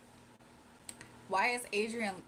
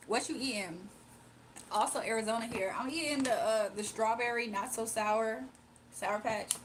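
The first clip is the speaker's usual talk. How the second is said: ordinary talk, nothing marked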